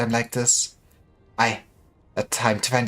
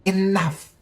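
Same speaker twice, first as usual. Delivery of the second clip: angry cut-off; 5 semitones higher than usual. Acted. Cuts off the argument.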